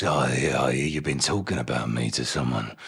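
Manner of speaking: low, quiet menacing Cockney confrontation; hushed, low-pitched villain warning; Mister Hyde impression. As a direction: lean in low and soft, quietly threatening. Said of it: "he kind of goes".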